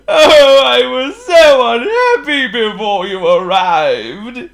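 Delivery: high, slow, loud wail; hammed-up sobbing lament; wailing king. A narrator's reading. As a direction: wail tearfully, stretching the words. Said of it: "he wailed".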